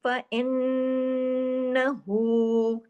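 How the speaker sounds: very slow chanted recitation; Quran recitation. Shown as a demonstration. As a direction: recite with idgham, drawn out. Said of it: "बड़ा गुन्ना हुआ". (धीमी तजवीद वाली तिलावत)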